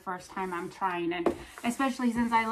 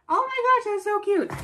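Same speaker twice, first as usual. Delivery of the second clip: excited squeal; 12 semitones higher, 8 dB louder than usual. Real, not acted. Squealing over cute pizza.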